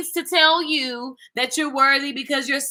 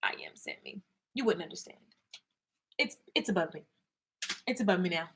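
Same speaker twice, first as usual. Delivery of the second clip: whisper; 8 semitones lower, 16 dB quieter than usual. Pretend. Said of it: "whispered, mock mysterious".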